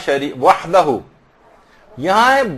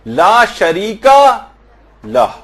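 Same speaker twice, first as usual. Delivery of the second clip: لا شریک, then a clear pause, then لہ; pause before لہ; 3 semitones higher, 4 dB louder, much slower than usual. Shown as a demonstration. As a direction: break after شریک and say لہ alone. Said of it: "لہ کو الگ پڑھیں". (لا شریک کے بعد وقفہ، پھر لہ)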